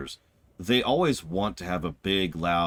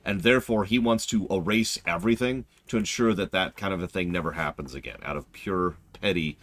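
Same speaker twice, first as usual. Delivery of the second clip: ordinary talk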